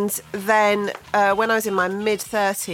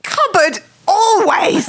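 loud, high, drawn-out mock indignation; comically emphatic, high outburst; mock outrage; 11 semitones higher, 7 dB louder, slower than usual. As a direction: cry it out slowly in hammed-up outrage. Mock outrage at ketchup question.

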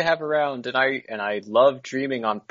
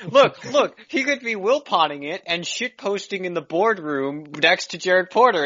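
laughing; 6 semitones higher than usual. Real, not acted. Laughing while riffing on owner.

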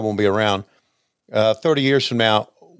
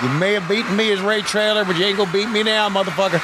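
mock wrestling promo; 9 semitones higher than usual. Mock wrestler promo after chair clip.

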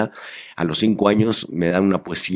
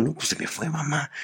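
put-on small quiet kid voice, higher; sheepish reply to mom, quiet and higher; sheepish child; 7 semitones higher, 8 dB quieter than usual. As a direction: answer like a shy five-year-old.

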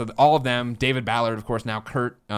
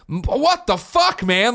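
disbelieving outburst; 10 semitones higher, 4 dB louder, much slower than usual. Retold or imitated. Retold exasperation at Herman.

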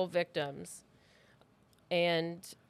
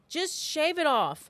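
emphatic; 6 semitones higher, 4 dB louder than usual. Real, not acted. Ranting at bald people.

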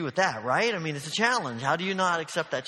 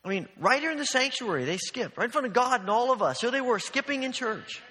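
much higher and louder, thrilled; delighted amazement; 8 semitones higher, 6 dB louder than usual. Real, not acted. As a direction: marvel out loud that they skipped in church. Marvelling at girls skipping in church.

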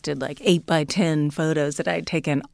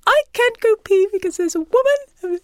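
very high South African friend impersonation; really high-pitched imitation. South African guest reporting a ghost at the bed, high-pitched imitation. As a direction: mimic her way up high, in a put-on accent. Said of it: "she talks like that really high pitch".

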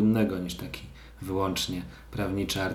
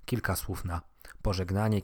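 ordinary talk

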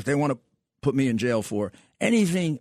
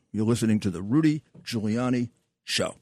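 ordinary talk

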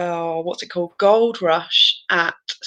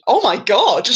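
mock shout of amazement, louder than usual; retold shout; 5 dB louder than usual. Rider retelling the first stall on Wildfire, mock shout. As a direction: cry it out as a retold yell. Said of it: "I literally just shouted".

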